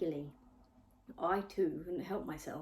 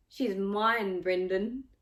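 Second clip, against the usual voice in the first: louder, possessive taunt; lizard-faced boy taunting the narrator, louder; taunting bully; 8 dB louder, slower than usual. A narrator's reading. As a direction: claim her to rile the boy. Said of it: "continued to taunt me".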